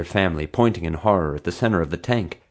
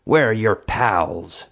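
slow, pressing demand from a guard; 3 semitones higher, much slower than usual. A narrator's reading. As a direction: drag the question out like an order. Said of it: "she demanded".

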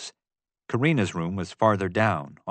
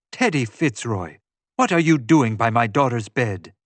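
loud, indignant mother's challenge; imposing, accusing question; stern demand; 4 dB louder than usual. A narrator's reading. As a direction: confront an intruder by your daughter's bed. Mother confronts intruder by bed.

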